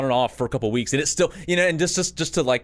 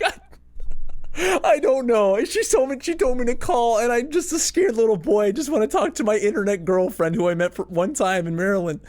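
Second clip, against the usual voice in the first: put-on much higher, panicky kid voice; scared little boy; 9 semitones higher than usual. Teen on the phone with his girlfriend's dad, panicking. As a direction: stammer it out like a terrified little boy. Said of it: "I'm, like, freaking out".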